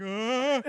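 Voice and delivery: high panicked scream with a laugh; retold scream. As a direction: scream in terror, laughing through it. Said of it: "he's like".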